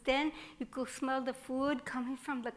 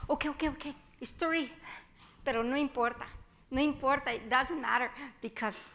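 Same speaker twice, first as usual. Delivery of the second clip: usual speaking voice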